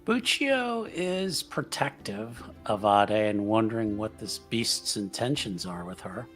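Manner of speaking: ordinary talk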